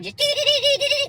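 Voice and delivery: high mock beeping like a dashboard alarm; beeping noise. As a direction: chirp it out like a warning beep. Imitating truck beeping Morse code.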